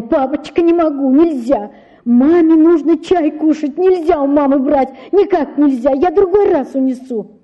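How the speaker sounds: выше и громче, с мольбой; умолять отца, почти плача; умоляющий детский голос. A narrator's reading. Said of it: "бросаясь на колени и умоляя его". (девочка умоляет отца, высоким голосом)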